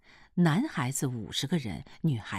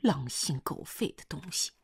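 hushed scolding; 5 semitones higher, 3 dB quieter than usual. A narrator's reading. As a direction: scold softly so nobody overhears. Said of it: "还有个女的在悄悄地说话".

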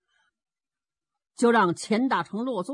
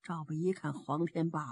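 ordinary delivery, nothing marked

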